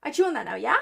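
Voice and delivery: high, loud, eager voice for the cat; cat's voice. Retold or imitated. Owner voicing what her smart cat would say, cat's voice. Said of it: "he'll be like".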